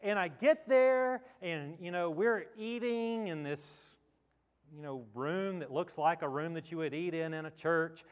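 ordinary talk

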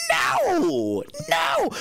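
high, wailed no; mock disbelief. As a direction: cry it out in exaggerated disbelief. Acted disbelief at the news.

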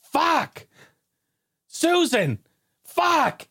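high-pitched mock yell of an angry neighbor; neighbor cursing. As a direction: squeal the curses up high like a frantic guy. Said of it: "I hear".

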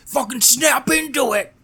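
high, slow, growled wrestler promo; over-the-top pro wrestler voice; Macho Man. Retold or imitated. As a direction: channel Randy Savage snapping a catchphrase. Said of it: "That's a Macho Man thing".

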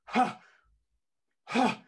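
high, loud mock-outraged "Ha!"; fuming huff. Club owner refused entry by the doorman, fuming huff. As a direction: splutter like a thwarted big shot.

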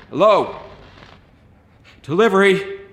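loud, high, worried calls; calling out. A narrator's reading. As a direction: call out uneasily into the dark. Calling down into the house.

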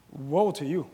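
low, laughing mock curse; playful mock scolding of his wife; joking woe to wife. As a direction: tease her with a low chuckling woe. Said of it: "I'm gonna look at my wife".

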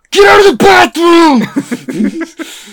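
high, loud, strained screech; mock screaming through a bathroom door; drawn-out hammed-up shriek; imitated brother yelling. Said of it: "and he's like".

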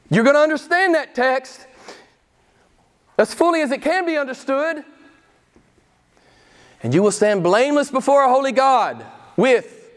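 high, loud rising preacher's climax; preaching at full force. Acted. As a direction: build it up to a ringing peak.

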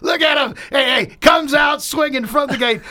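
laughing, loud, high; excited shout. Real, not acted. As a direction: hype him up with a shout. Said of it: "that voice you heard".

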